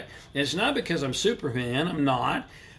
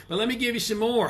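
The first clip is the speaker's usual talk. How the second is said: ordinary talk, nothing marked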